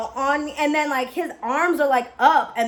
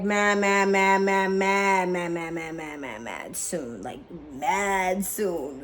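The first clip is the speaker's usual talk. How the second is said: fading repeat; 4 semitones lower, 4 dB quieter, slower than usual. Real, not acted. Repeating "mad" to stress how soon.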